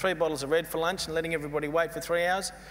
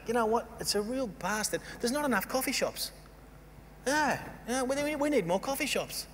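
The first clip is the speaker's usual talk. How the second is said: higher, half-hearted mock bloke voice; 7 semitones higher, 3 dB quieter than usual. Speaker mocking imagined blokes to the audience, lightly voiced. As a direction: lampoon the blokes begging for coffee shops.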